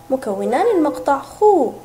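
ordinary talk, nothing marked

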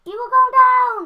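loud threat. Real, not acted. Gamer taunting his opponent in the match, loud mock threat. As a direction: threaten him loudly and gleefully.

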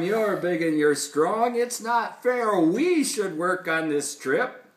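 loud crowd complaint from a story; yelled protest. A narrator's reading. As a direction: protest loudly, slowing into a whine of unfairness. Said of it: "yelled the throng".

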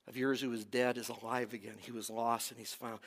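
father gently pleading with angry son; tender appeal. Acted. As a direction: hush it like a loving father. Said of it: "He appeals to him".

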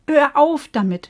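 loud, sharp telling off; scolding. Acted. Bo scolds the fish hitting the glass, loud. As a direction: stop him firmly. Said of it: "schimpfte Bo".

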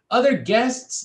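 high, loud incredulous cry; indignant outburst. A narrator's reading. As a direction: explode in disbelief. Lewis indignant.

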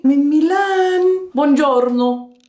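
high, slow diva voice; posh model voice. Pretend. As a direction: play a glamorous runway model. Posing as Milan model.

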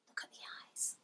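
a hushed, breathy aside; whisper. Real, not acted. Reader whispers to the listening child about the eyes.

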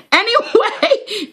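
high slow laughing anyway; drawn-out giggling anyway. Real, not acted. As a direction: laugh through the word. Laughing still.

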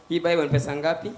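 bargaining in a low, soft voice; low sly haggle; low haggling voices. Acted. Haggling over a Bible price.

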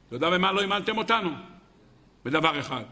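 ordinary talk

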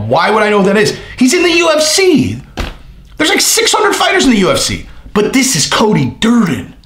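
mock the friend's clueless protest; friend's reply voiced high and incredulous; mock friend retort.

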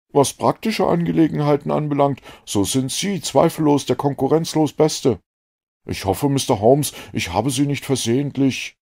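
put-on a keen, relieved young man; a lightly voiced eager doctor. A narrator's reading.

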